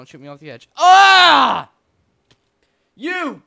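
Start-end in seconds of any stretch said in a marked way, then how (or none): 0.8-1.7 loud high scream, dropping in pitch; drawn-out shriek; falling scream. Real, not acted. Shot off the edge. 3.0-3.4 high, loud "you!"; outraged high holler; shouted accusation. Real, not acted. Shouts at partner who shot.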